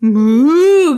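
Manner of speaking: loud playful cow imitation; cow moo. Shown as a demonstration. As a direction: moo big like a cow for a toddler. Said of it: "cow make?".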